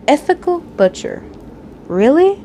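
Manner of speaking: dripping sarcasm, rising disbelief; sarcastic. A narrator's reading. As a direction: scoff at the phrase in disbelief. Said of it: "Your voice dripped with sarcasm".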